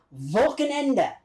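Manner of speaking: Wochenende said slowly as a German word; German pronunciation. Shown as a demonstration. Teaching viewers a German word, Wochenende pronounced slowly in German. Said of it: "It's German for weekend".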